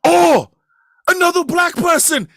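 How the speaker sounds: loud, high mock Irish accent; thick Irish accent. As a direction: imitate a thick Irish brogue, loudly. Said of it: "has the thickest Irish accent".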